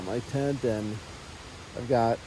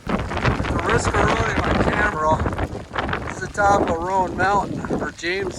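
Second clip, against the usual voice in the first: shouting over wind; 6 semitones higher, 6 dB louder than usual. Real, not acted. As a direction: call it out over the gale.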